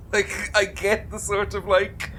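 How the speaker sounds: ordinary talk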